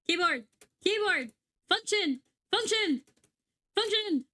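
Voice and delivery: high, punched single words; exasperated calling out. Real, not acted. Streamer to her disconnected keyboard, exasperated calling out.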